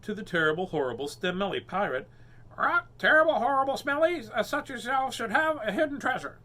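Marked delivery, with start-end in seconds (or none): 2.6-6.4 high, loud parrot squawk; high parrot talk. Acted. Parrot Polly speaks to pirate.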